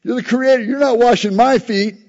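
high, insistent disbelief; shocked protest. Retold or imitated. Peter protesting to Jesus, high pitched insistent disbelief. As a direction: Peter can't believe the role reversal, voice up high. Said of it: "he was like".

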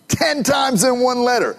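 preaching shout; preacher shout. Real, not acted. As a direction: proclaim it to the room.

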